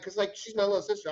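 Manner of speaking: ordinary delivery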